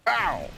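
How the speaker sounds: high "ow" falling sharply; pained yelp. Real, not acted. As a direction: yelp as the hit lands. Player reacts to being hit.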